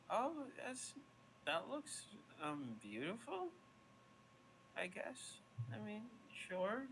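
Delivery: high, hesitant, unconvinced fairy; unimpressed fairy. Acted. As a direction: feign polite interest as the fairy. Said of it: "she goes".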